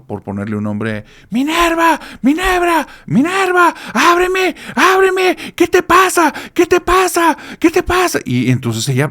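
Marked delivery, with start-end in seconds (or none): 1.3-8.2 high, loud yelling of a name through a door; frantic shouting. Retold or imitated. Husband yelling for his wife through the bathroom door, high and loud. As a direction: pound on the door and yell for her, much higher. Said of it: "le está gritando".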